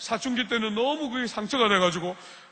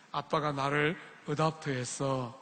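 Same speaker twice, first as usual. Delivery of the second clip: put-on softer and slow, a boy shrugging it off; smiling child's reply; 6 semitones lower, 5 dB quieter, slower than usual. 매튜가 유학생에게 웃으며 답하는, 부드럽고 느린 목소리. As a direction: Matthew answering the student with an easy smile, softer and slower. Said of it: "뱅그레 웃으면서 말했어요".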